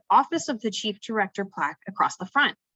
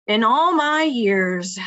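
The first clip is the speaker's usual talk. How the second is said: loud, drawn-out disbelief; disgruntled grumble; 3 semitones higher, 7 dB louder, much slower than usual. A narrator's reading. Agent shakes his head at reckless idea, disgruntled. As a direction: complain slowly and emphatically.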